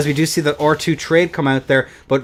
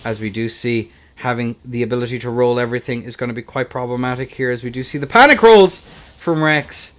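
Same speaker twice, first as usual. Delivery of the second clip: ordinary delivery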